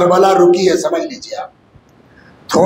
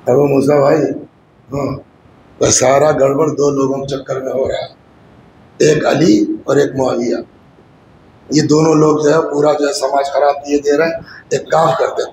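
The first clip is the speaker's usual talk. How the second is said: lower, sly confidential tone; scheming voice; 6 semitones lower than usual. Retold or imitated. Amr al-As slyly coaxing Abu Musa, low and scheming. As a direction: coax him like a schemer.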